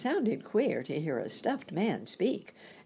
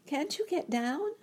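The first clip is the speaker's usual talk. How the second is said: put-on young girl voice; 13 semitones higher than usual. A narrator's reading. Dorothy voiced in story.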